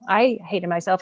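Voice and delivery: normal talking voice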